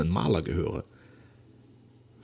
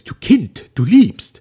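much higher and louder outcry; exclaiming; 11 semitones higher, 15 dB louder, much slower than usual. A narrator's reading. Father exclaiming as he guesses his daughter's love. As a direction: burst out in realization. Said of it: "so dass er ausrief".

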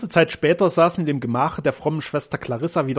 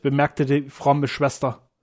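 ordinary delivery, nothing marked

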